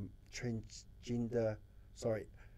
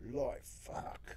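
shocked whisper; 4 dB quieter than usual. Retold or imitated. Retold shocked reaction to student.

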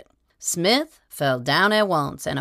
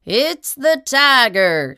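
loud, high, drawn-out alarm; alarmed cry; 9 semitones higher, 6 dB louder, slower than usual. A narrator's reading. Bill warning the drinkers about the tiger, loud high alarm. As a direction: sound the alarm, slow and loud.

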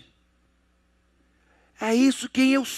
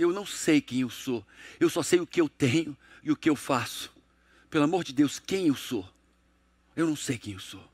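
softer, mildly tearful retelling of a man breaking down; retold crying; 8 semitones lower, 6 dB quieter than usual. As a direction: quietly imitate a grown man in tears, understated. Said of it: "começou a chorar e disse". (imitando de leve um choro, mais baixo)

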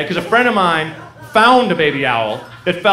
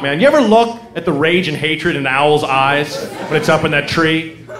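usual speaking voice

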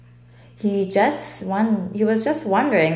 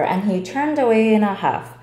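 ordinary delivery

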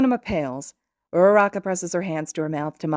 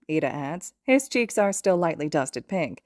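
plain reading voice

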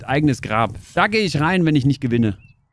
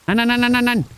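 high, rapid string of no; panicked protest at the flooding; frantic nos; 8 semitones higher, faster than usual. Real, not acted. (hoch und panisch nein rufen)

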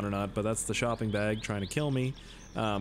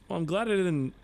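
ordinary delivery

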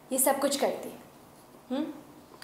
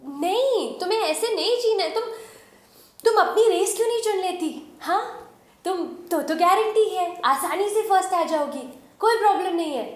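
high, louder, pleading encouragement; impassioned urging; 7 semitones higher, 5 dB louder than usual. Acted. Companion tells the heroine to pick her own race, impassioned and high. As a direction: urge her on with soaring, forceful pitch.

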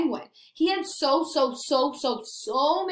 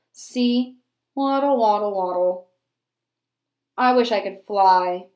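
lightly voiced bored penguin, a touch lower; mopey penguin; 5 semitones lower, 4 dB louder than usual. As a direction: sulk your way through it like a glum little penguin. Penguin speaks in story.

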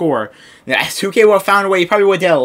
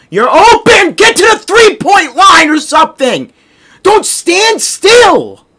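loud, high frustrated shout; exasperated yelling; 11 semitones higher, 5 dB louder, slower than usual. Real, not acted. Gamer to teammate standing still, exasperated shouting. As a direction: yell orders at a useless teammate.

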